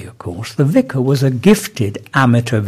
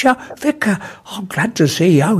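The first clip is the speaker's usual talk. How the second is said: flustered greeting; 7 semitones higher than usual. Acted. Startled Enoch greets vicar in joke.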